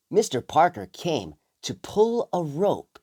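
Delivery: ordinary delivery, nothing marked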